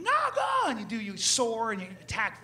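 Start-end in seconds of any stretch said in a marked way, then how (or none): 0.0-0.7 high falconer command. Retold or imitated. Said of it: "and they".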